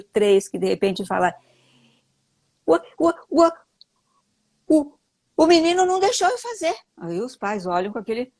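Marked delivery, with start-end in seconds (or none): 2.7-6.7 put-on high, far-off little-kid voice, stuck on the first sound; stuttering toddler. A little boy stammering to his parents, childlike and high. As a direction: play a small child stammering out a complaint. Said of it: "vem aquele seu menininho de dois anos e meio".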